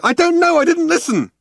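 very high, loud retort; high-pitched exasperated cry; exasperated outburst. A narrator's reading. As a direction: burst out in helpless frustration. Arthur snaps back, exasperated.

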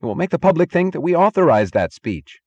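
high, loud alarm; screamed. A narrator's reading. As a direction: cry out in panicked protest. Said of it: "screamed Wesley Mooch".

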